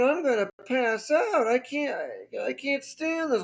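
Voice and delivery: high mock-distressed voice; freaking out. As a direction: imitate a nurse about to faint. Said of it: "my nurse started to freak out".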